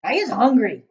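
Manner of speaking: loud booming giant voice. Acted. Giant announcing his hunger to Sophie, loud boom. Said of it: "the giant boomed".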